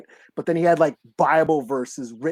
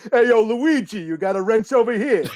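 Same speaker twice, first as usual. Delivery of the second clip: mock Brooklyn mobster accent, lightly done; a hint of a Brooklyn wiseguy calling Luigi; Brooklyn Goodfellas accent; 3 semitones higher, 3 dB louder than usual. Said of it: "the Brooklyn Goodfellas accent".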